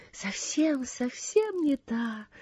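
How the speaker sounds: soft, slow, drawn-out whisper; hushed and lingering. A narrator's reading. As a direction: whisper it slowly from far away. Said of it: "прошептала Сесси".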